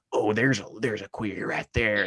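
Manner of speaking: light Southern homophobe drawl, slowed; a hint of a Southern bigot; Southern accent. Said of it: "I gave him that accent right there".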